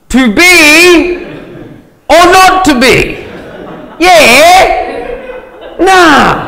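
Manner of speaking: high, theatrical declamation with drawn-out words; hammed-up Shakespearean actor. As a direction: declaim it grandly as mock Shakespeare. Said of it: "Shakespeare used to say".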